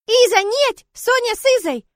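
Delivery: put-on high, loud little-girl wail; pleading child. Sonya begging to go with Iza, childish wailing. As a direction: beg as a distraught small child.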